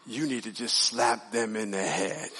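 low, slow, soft pretend inner voice; mock small still voice. Preacher joking about a false voice of God, mock hushed voice. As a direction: murmur it slowly as a fake divine prompting. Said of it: "still voice say".

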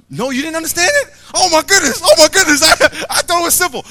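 high, loud, fast mock disbelief; playful exasperation. Real, not acted. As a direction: throw up your hands at the crowd.

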